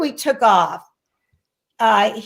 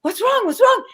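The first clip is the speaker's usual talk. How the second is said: concerned coworker; 12 semitones higher than usual. Retold or imitated. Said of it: "She sees me crying. She goes".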